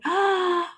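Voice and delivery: a real, quick gasp of surprise; sharp gasp. Real, not acted. Reader gasps at a viewer's validating news. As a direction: catch your breath in shock.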